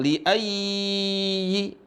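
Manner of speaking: chanted "ayyi" with an overlong glide; wrongly lengthened. Shown as a demonstration. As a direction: hold the yy of "ayyi" long. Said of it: "ini nggak boleh dibaca panjang". ("ayyi" salah dipanjangkan)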